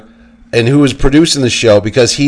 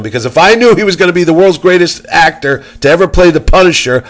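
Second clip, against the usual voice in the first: usual speaking voice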